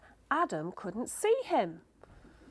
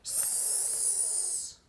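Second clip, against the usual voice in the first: long unvoiced hiss like a snake; hissing snake sound; 10 dB quieter than usual. Boy hissing like a snake to scare his brother. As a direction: hiss softly through the teeth. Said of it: "He went".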